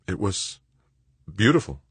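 lamely, searching for the word. A narrator's reading. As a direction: trail out a weak reply, with a pause. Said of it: "Doug said lamely".

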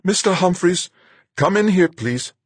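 very high, loud call. Acted. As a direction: call him in from the hatch. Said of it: "Sanja called out to me".

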